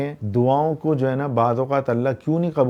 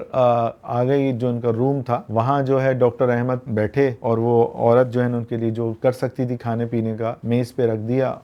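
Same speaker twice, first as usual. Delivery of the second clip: ordinary talk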